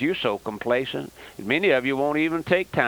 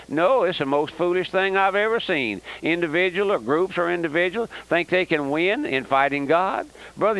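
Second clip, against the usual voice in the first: normal talking voice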